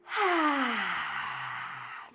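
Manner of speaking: long audible sigh out through the mouth; demo sigh. Shown as a demonstration. Teacher showing the class how to sigh the breath out. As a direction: let the breath fall out in one big sigh. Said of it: "And one more like this".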